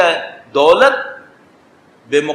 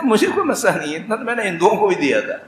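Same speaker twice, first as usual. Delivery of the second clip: quicker, laughing mockery of the boast; mocking laugh; 3 semitones higher, 4 dB quieter, faster than usual. Preacher retelling the donor's boast to the congregation, mocking with a laugh. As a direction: laugh off the rich man's bragging. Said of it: "اس نے کہا".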